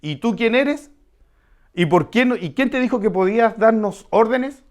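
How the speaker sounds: half-acted annoyance at the stranger giving orders; indignant worker's challenge. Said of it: "empiece a dar órdenes".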